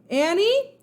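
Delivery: high, loud questioning call. Acted. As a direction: call into the lit hallway. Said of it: "he called".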